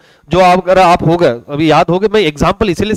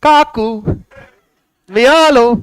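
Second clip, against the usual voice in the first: raised-pitch call to the aunt next door; calling neighbour aunt; 5 semitones higher, much slower than usual. Retold or imitated. Yash at the neighbour's door, holding out a plate, a mildly raised call. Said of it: "है ना".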